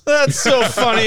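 very high, loud, laughing mock delight; mock amused Bob. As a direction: gush with laughter at the joke.

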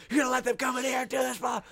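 high, strained coach rant; starting to yell. Retold or imitated. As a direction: imitate a coach working up to a yell. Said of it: "he's, like, starting to yell".

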